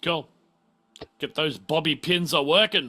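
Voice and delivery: ordinary talk, nothing marked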